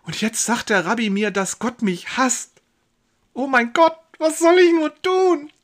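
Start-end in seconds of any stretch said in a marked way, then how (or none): 3.3-5.5 high, loud anguished wail to God; despairing cry. Acted. Josef cries to God after the rabbi's verdict, desperate loud wail.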